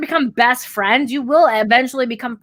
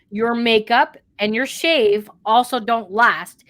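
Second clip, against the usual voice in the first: ordinary talk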